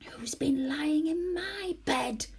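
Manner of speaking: a whispered growl, slow and breathy; growly voice. A narrator's reading. Father Bear growling at the sleeping stranger. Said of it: "said Father Bear in a growly voice".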